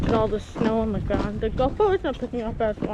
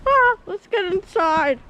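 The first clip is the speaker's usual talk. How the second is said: calling out; 9 semitones higher, 6 dB louder, slower than usual. Real, not acted. Freezing, calling out.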